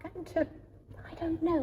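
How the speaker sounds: whispering. Real, not acted. Hushed reply about Christmas decoration.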